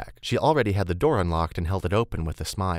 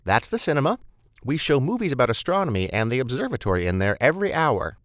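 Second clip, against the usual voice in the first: higher, lively guide voice; chatty tour-giving Beth; 6 semitones higher, 4 dB louder than usual. Acted. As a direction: show the newcomer round with bubbly energy.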